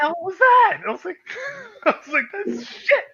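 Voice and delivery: high, squeaky laughing outrage; laughing disbelief. Retold or imitated. Player laughing at his own surprise position switch, squeaky and high. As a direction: laugh it out in mock horror.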